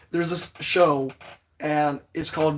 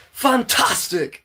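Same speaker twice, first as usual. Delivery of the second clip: slow, higher, louder theatrical fantastic; fancy voice; 7 semitones higher, 4 dB louder, slower than usual. Shown as a demonstration. Streamer demonstrating her fancy reading voice, slow showy fantastic. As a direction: stretch it out grandly, showing off. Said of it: "I'll read a little bit of Powerless".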